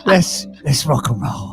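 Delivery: slow drawn-out mock hype; slow teasing rock and roll; mocking Ashley. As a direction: stretch it like a cheesy pep talk. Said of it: "mocks Ashley's quote".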